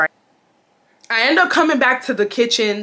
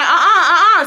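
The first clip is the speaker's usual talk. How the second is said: playful refusal; 8 semitones higher, 5 dB louder than usual. Retold or imitated. Refusing him at the door.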